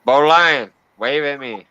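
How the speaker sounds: normal talking voice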